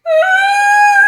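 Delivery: loud, very high hammed-up sob; mock sobbing wail. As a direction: burst into a theatrical wail. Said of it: "start sobbing".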